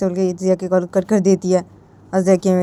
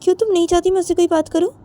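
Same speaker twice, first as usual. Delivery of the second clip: mother's reply; 9 semitones higher, faster than usual. Acted. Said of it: "अब तो जिया की".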